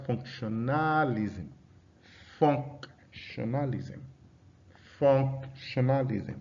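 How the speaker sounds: "Functionalism" slowly, FUNC set apart from the rest; stress on first syllable. Shown as a demonstration. Teacher drilling first-syllable stress, slow "Functionalism" with FUNC separated. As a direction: hold FUNC in "Functionalism", pause, then the remaining syllables. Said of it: "on the first label".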